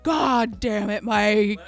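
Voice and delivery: fuming. Retold or imitated. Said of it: "I was just fuming".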